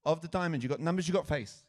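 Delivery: loud, rapid-fire choices. Real, not acted. Host pressing a volunteer to pick a suit, rapid-fire. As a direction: fire the options out loudly, no hesitation. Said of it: "Even quicker".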